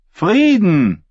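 high, ringing one-word declaration; declaring. A narrator's reading. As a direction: proclaim it to the hall. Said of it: "erklärte Asche".

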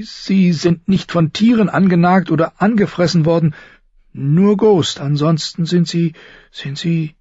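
nervous, high, halting voice; high and hesitant, feeling for each word; Samwell Tarly. Acted. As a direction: stammer timidly to a superior. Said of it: "Sams nervöse, hohe Stimme".

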